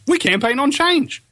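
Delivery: high-pitched mocking slogan; mock campaign slogan.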